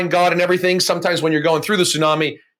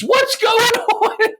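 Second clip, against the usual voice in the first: high, drawn-out wail breaking into a laugh; anguished cry; much slower than usual. Retold or imitated. To himself, crying on his knees in the back room: high, slow anguished wail. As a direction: stretch it out like a desperate cry. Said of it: "I'm crying on my knees".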